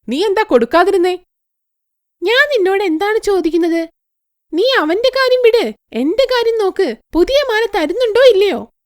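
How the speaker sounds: high, pushy voice insisting on the necklace; demanding greedy girl. Acted. (ഉയർന്ന സ്വരത്തിൽ വാശിയോടെ ചോദിക്കുന്ന പെൺകുട്ടി)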